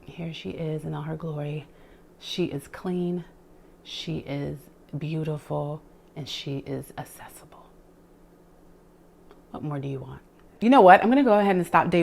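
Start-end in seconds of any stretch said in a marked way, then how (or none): 0.1-5.8 soft proud reveal. Real, not acted. Shows off the finished shelf. 6.1-7.7 soft breathy whisper. Real, not acted. Whispers at the end of the reveal. 9.5-10.2 soft proud reveal. Real, not acted. Shows off the finished shelf.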